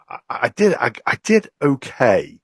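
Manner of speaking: ordinary talk, nothing marked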